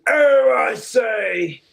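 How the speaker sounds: a hammed-up aristocrat exclaiming; posh British impression.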